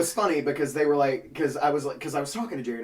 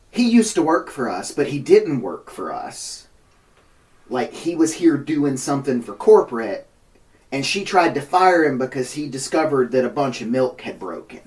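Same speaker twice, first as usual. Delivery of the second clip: raised, insistent retelling; indignant defense; 2 semitones higher, 10 dB louder than usual. Retold or imitated. Deli worker loudly defending him to the cop. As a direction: stand up for him, half shouting.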